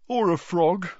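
tired yawn through words. A narrator's reading. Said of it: "yawned Harry".

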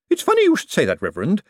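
high, nervous chatter; nervous lady. A narrator's reading. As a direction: start high and eager, nerves showing. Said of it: "her eyes bright with nervousness".

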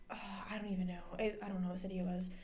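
soft, quick whisper to herself; whispered aside. Real, not acted. Pausing her pho story to recall the city, whispering. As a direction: mutter it under the breath, searching memory.

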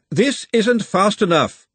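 high, slow complaint called out; moderate shout. A narrator's reading. Glenda complains after bouncing over potholes, half-shouted. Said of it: "Glenda shouted".

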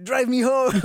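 high, loud mock plea of drunk coworkers; imitating demanding coworkers. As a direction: beg for a ride home, hammed up. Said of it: "And then they were like".

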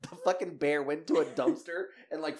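ordinary talk

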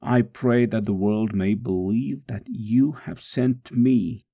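usual speaking voice

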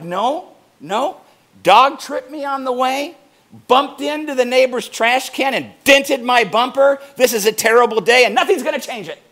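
loud, grumbling bad-day rant, speeding up at the end; grumpy complaint. Imitating a sour morning mindset in a loud grumbling rant. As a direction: whine like the whole day is ruined. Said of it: "Versus like".

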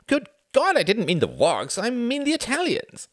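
put-on exasperated old Sam. Sam correcting Reginald impatiently. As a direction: bluster the correction.

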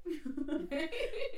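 loud, open laugh. Real, not acted. Guest admits secret plan to hosts, laughing. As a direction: laugh at your own plan.

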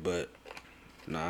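usual speaking voice

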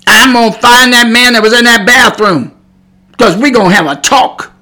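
hot mad. Retold or imitated. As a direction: act out an angry dad on the hunt. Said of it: "I would have been hot mad".

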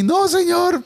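high squeaky no señor; put-on voice. Shown as a demonstration. Showing the wrong voice to use, high. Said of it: "si pones una voz muy así".

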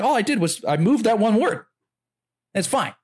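high, excited comic punchline; imitating Bill Burr. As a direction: squeal it out indignantly high. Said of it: "He's like".

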